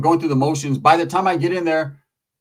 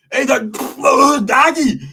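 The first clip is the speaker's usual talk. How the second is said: high, slow, stammering mock voice; flustered Big Calvin; 9 semitones higher, 5 dB louder, slower than usual. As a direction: sputter like a man losing control. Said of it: "He was like".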